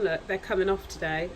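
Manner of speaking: usual speaking voice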